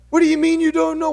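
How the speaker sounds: high, loud, rapid mock-mom retort; exasperated mom. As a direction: fire back as an incredulous mother. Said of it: "She was pretty angry".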